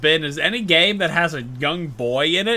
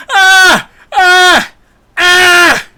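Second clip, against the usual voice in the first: repeated hammed-up screams, much higher and louder; mock screaming enemy; 15 dB louder, much slower than usual. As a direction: scream like a burning guard. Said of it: "He's screaming".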